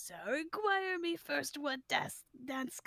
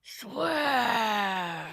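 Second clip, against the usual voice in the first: ghost voice; 3 semitones lower, 8 dB louder than usual. Acted. Ghost calling from below stage.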